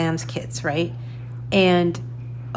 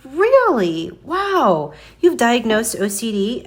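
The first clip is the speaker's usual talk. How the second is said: surprised; 5 semitones higher, 3 dB louder, slower than usual. Retold or imitated. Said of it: "And I was like".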